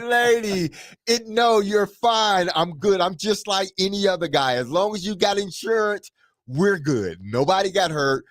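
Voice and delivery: high-pitched retold reassuring, hurried to soothe; insistent reassurance. Reassures the crying driver, retold.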